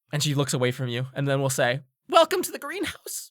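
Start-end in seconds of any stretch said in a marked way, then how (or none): 2.1-3.3 high put-on character voice; odd greenhouse keeper. Greenhouse keeper greeting a visitor, high character voice. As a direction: pitch the greeting way up.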